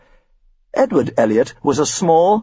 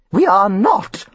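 higher, mock-tearful agreement; hammed-up weepy Mrs Elliot; tearful mother; 8 semitones higher, slower than usual. A narrator's reading. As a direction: echo him on the verge of tears. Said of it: "Missus Elliot agreed, bursting into tears".

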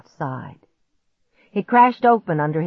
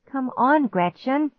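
higher, pleading voice; 8 semitones higher than usual. A narrator's reading. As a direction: beg her to come. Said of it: "Clark pleaded".

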